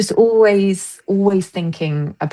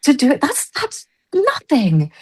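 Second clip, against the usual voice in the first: incredulous co-star; 9 semitones higher than usual. Retold or imitated. Co-star protesting the short rehearsal time, high incredulous. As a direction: scoff at how little rehearsal there is. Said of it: "she was like".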